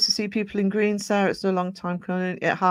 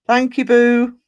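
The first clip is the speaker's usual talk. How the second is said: higher and louder, delighted thank you; excited thanks; 7 semitones higher, 11 dB louder, slower than usual. Real, not acted. Streamer thanking a donating viewer, excited and loud. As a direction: burst out with thanks.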